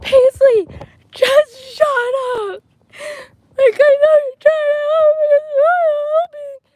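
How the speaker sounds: high-pitched wailing shout, hammed up; re-enacted tearful yell. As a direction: act out yelling at a friend through tears. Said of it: "I turn back and I yell".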